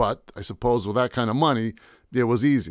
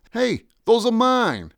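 much higher, slow alarmed protest; 11 semitones higher, 4 dB louder, slower than usual. Acted. Painter to the goon grabbing his paintings, alarmed. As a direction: try to stop him taking them.

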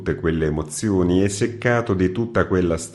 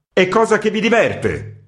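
much higher angry cry; angry shout; 14 semitones higher, 5 dB louder than usual. Acted. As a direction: hurl the accusation. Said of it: "gridò il redattore con tono iroso". (grido iroso, molto più acuto)

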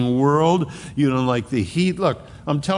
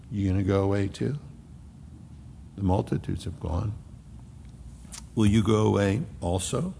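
softer, lower, a quiet plea; soft tender question; 6 semitones lower, 7 dB quieter than usual. Acted. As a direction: ask it gently, almost hurt. Jesus voiced asking the twelve.

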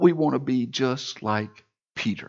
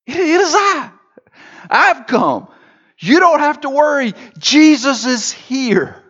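much higher, louder proclaiming; Jesus declaring loudly to his frightened disciples; rising proclamation; 9 dB louder than usual. Retold or imitated. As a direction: ring out that help has come. Jesus reassuring, voice swells up.

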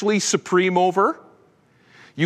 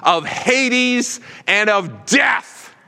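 higher, building to a shout; rising proclamation; 6 semitones higher, slower than usual. Acted. Preacher voicing Jesus to congregation, building to a shout. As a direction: proclaim it, climbing to full voice.

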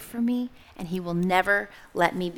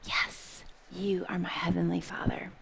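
breathy unvoiced whisper of trust; 2 semitones lower, 6 dB quieter than usual. The baby bird whispering back to its heavenly Father. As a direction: whisper the answer softly.

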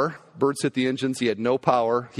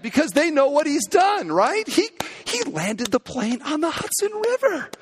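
ordinary delivery

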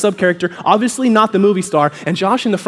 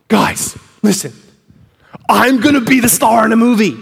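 mock self-important movie-star announcement; a hint of pompous showman; grand announcement; 4 dB louder than usual. Acts Josh's grand announcement on stage.

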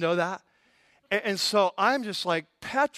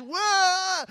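imitated wail; 13 semitones higher, 4 dB louder than usual. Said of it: "they were just".

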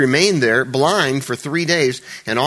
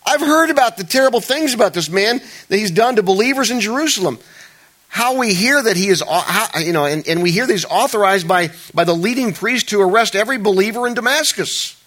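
higher, louder worried pleading; anxious protest; 9 semitones higher, 5 dB louder than usual. Acted. Preacher voicing Ananias pleading fearfully with God about Saul. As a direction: plead with rising worry.

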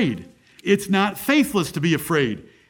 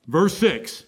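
ordinary talk, nothing marked

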